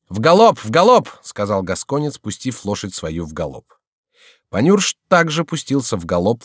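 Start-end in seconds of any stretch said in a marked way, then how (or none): 0.1-1.1 loud, high riding command; brisk, ringing order; rallying shout. A narrator's reading. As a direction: call out as you spur the horse. Rider calls for a gallop.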